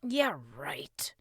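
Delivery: low-key sceptical grumble; grumbled. A narrator's reading. Boy doubts the king's pep talk, grumbled. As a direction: grumble it dismissively. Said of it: "Russ grumbled under his breath".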